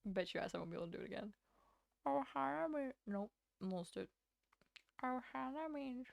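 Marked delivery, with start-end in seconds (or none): 2.1-2.9 Stitch voice attempt. Shown as a demonstration. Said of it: "doing the Stitch guy". 5.0-6.1 Stitch voice attempt. Shown as a demonstration. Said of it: "doing the Stitch guy".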